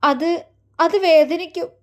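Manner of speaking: a frightened bride confessing her fear, tearful; tearful and afraid. Acted. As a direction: stumble over the words, close to crying. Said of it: "അവളിൽ നിന്നൊരു തേങ്ങലുയർന്നു".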